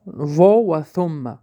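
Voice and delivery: ordinary delivery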